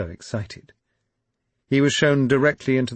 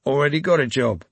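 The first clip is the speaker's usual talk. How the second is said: growled; 6 semitones higher, 5 dB louder than usual. A narrator's reading. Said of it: "he growled".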